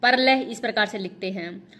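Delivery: usual speaking voice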